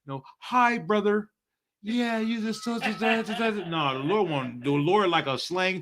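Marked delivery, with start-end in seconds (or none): 0.4-3.7 high, airy fake-nice brother; a lightly voiced churchy phony; prissy church voice. Mocking a fake-nice brother, mild prissy voice. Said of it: "The Lord don't want no".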